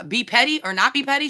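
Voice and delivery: usual speaking voice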